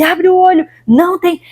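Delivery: lightly voiced urging spirit; retelling the Exu telling her to open her eyes; Exu's command.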